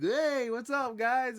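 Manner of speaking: high, breezy mock hello; mock carefree greeting. Imitating a hero casually greeting friends despite grief, carefree. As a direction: greet them far too cheerfully.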